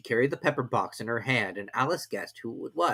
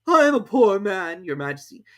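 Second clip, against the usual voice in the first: trembling Hatter; 9 semitones higher, 9 dB louder than usual. A narrator's reading. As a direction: plead with the king, trembling. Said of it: "in a trembling voice".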